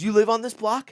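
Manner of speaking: high, needling question; retold challenge. Angry stranger challenging the bystander, high needling question. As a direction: pitch it up, confrontational.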